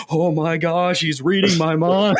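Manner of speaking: high, playful astonished panic; hammed-up alarm, stretched at the end; mock freak-out. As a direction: act out being spooked, rising into a laugh. Retold freak-out at mind reading.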